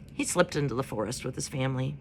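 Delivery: plain reading voice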